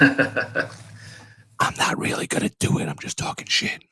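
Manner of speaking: conspiratorial whisper. Real, not acted. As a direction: whisper so the wife can't hear. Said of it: "I have to whisper".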